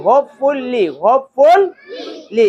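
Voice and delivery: "হোপফুলি" in three separate beats; syllable by syllable. Shown as a demonstration. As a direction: sound out হোপ-ফুল-লি. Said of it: "এভাবে কি বানান ভুল হবে?".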